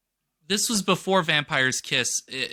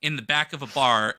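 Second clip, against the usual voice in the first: ordinary talk, nothing marked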